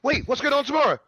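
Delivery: high, loud, startled question; alarmed question. Real, not acted. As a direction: blurt it out, suddenly alarmed. Sudden alarm about tomorrow.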